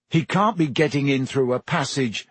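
ordinary delivery

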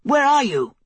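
high, urgent calling out; shout. A narrator's reading. Guard captain searching for the queen in darkness, urgent shout. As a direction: call out in alarm, pitched high. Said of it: "her guard captain shouted".

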